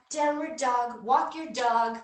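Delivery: ordinary talk, nothing marked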